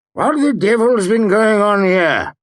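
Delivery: high, loud blustering demand. A narrator's reading. Innkeeper bursting in on the ruined room, loud demand. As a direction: bark at whoever wrecked the place. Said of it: "he demanded".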